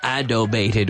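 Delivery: adobated said low, as the wrong answer; wrong word adobated. Shown as a demonstration. Teacher mocking the wrong answer adobated. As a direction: say adobated as a mistake to avoid. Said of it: "We don't say".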